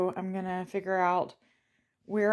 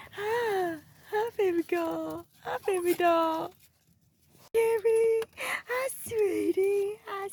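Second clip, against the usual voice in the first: high pet talk; 11 semitones higher than usual. Real, not acted. Greeting the excited dogs at the door, high cooing baby talk. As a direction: coo up high like greeting a puppy.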